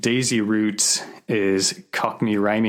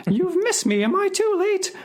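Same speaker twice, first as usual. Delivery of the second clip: much higher falsetto lady, breathless; much higher mock lady voice; flustered lady; faster than usual. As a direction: pipe it up like a fussing old dear. Lady chasing the dustman.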